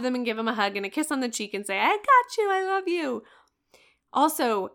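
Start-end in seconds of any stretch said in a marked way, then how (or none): 1.8-3.2 much higher, sing-song and loud on the catch; high playful mom voice. Retold or imitated. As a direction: squeal it like you just caught the kid in a game. Hug chase, catching the child.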